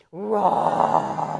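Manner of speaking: playful lion roar. Shown as a demonstration. Teacher roars like a lion with the children, playful roar.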